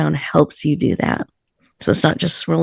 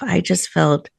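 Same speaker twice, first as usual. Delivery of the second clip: ordinary talk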